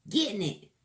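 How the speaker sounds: Getting it in casual slangy British; slangy British accent. Shown as a demonstration. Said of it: "one in a slangy British accent".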